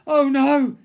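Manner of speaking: dread. Acted. Dad dreads.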